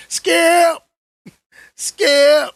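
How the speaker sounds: high, loud shout of Skip; Shannon Sharpe yelling. Retold or imitated. Calling out to his co-host, loud high shout. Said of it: "I'm looking like Shannon Sharpe".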